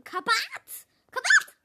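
from firm to loud high yell; building shout. Acted. Daughter yelling at mother to hang up, building. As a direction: push harder each time.

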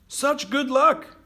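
very high, loud, drawn-out cheer; excited exclamation. A narrator's reading. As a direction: exclaim slowly and loudly, thrilled for the farmer. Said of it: "the neighbors exclaimed".

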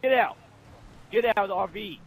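loud, high call; loud yell at a teammate; shouting. Real, not acted. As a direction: bark the order across the map. Yelling at player to leave.

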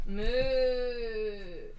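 cow moo. Acted. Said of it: "c'est toi la boîte à meux?".

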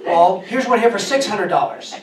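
imitating a fed-up clerk raising his voice; agitated ticket agent. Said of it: "getting more and more agitated".

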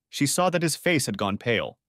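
ordinary delivery

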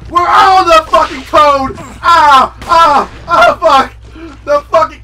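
loud, high shrieks; ambushed by a monster, screaming; terrified screams. Real, not acted. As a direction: scream at the jump scare. Frog attack jump scare.

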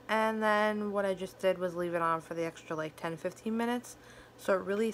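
slight lisp from fangs; speaking with fake fangs. Real, not acted. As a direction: teeth caps altering speech. Seen 3: Pointed fang caps visible on upper teeth while speaking throughout.

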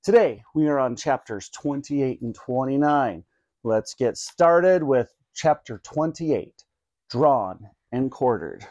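ordinary delivery

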